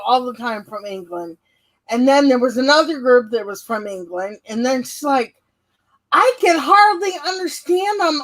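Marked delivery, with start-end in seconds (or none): 6.1-8.2 higher-pitched mock complaint; retold exasperation. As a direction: quote her complaining, pitched up. Retold complaint about English visitors.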